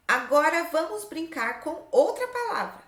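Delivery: lifted, deliberate classroom reading; reading out instructions. Shown as a demonstration. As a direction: read the exercise aloud to young pupils. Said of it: "Olha só o que tá escrito aqui".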